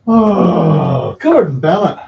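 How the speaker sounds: loud, high exasperated oath ending in a laugh; loud exasperated exclamation. Real, not acted. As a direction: blurt it out fed up, then laugh it off. Exasperated at dogs, then laughs.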